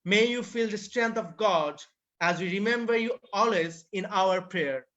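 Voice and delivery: ordinary talk, nothing marked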